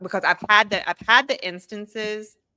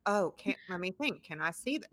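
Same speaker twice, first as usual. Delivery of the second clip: put-on kids musing; 2 semitones higher, 9 dB quieter than usual. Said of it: "they're all like".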